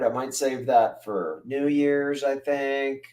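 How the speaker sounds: usual speaking voice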